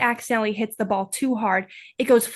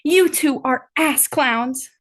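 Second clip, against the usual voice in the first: slow, raised-pitch mild mock yell; Haley's scolding yell; 4 semitones higher, slower than usual. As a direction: scold them slowly like squabbling small siblings. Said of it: "Haley yells at the two like they're five-year-old siblings".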